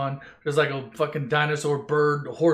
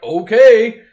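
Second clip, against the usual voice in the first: 1 loud okay; 5 semitones higher, 12 dB louder than usual. Acted. Sudden loud acceptance.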